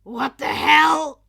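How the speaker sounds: loud, high shout; angry yell. Acted. Bakugo shouting after dropping into the room. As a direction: bellow it in shock.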